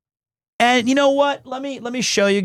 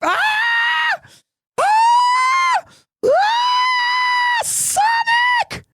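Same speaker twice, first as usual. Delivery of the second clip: very high piercing screams; excited shrieks at a clip; shrieking; much slower than usual. Real, not acted. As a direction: scream the name out. Screaming at a sound submission.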